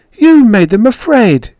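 high, loud accusation; furious high cry; shouting. A narrator's reading. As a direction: shout it through tears of anger. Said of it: "she shouted".

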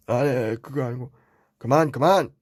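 normal talking voice

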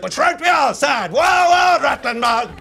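loud, rising mock bluster of a no-nonsense therapist; a hammed-up loud Irish shrink; rough Irish therapist. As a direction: bellow it like a dismissive old doctor. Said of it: "he'd be like".

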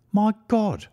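sudden high cry of dismay; cried out in shock. A narrator's reading. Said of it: "cried our client".

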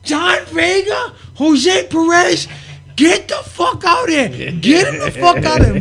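high, loud, long-drawn shout; calling out angrily. Retold or imitated. Promoter yelling back at the curtain, drawn-out angry shout. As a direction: holler the names as an irate promoter. Sparta calling wrestlers from the aisle.

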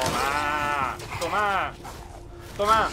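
loud taunting while firing; triumphant shouts. Real, not acted. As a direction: shout it with every hit. Shooting the enemy.

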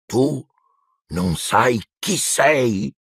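halting disbelief, pausing after the first word; incredulous. A narrator's reading. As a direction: stretch it out, unable to believe it. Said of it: "con uno sguardo incredulo".

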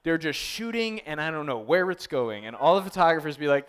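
ordinary talk, nothing marked

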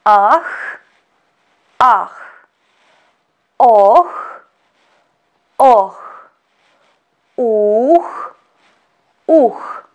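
Ah, oh, uh said clearly, each twice; model vowels. Shown as a demonstration. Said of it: "the second line of syllables".

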